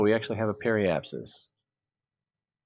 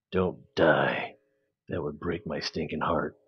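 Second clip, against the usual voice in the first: low, softer action-movie growl of "Don't die"; menacing action hero; 4 semitones lower, 4 dB quieter than usual. Pretend. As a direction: play it like a gruff hero in a trailer. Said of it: "Very menacing".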